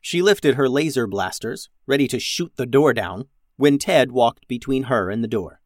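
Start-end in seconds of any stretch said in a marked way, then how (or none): none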